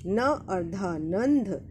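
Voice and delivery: normal talking voice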